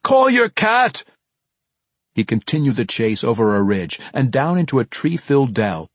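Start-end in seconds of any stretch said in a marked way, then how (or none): 0.0-1.0 high, slow, drawn-out holler; urgent long-distance shout; yelling. Acted. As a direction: holler the order across the woods. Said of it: "Bruner yelled".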